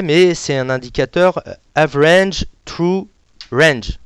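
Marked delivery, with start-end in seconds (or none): 1.7-4.0 Average True Range said slowly with an English accent; English pronunciation. Real, not acted. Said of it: "excusez-moi mon anglais". (prononcé à l'anglaise, lentement)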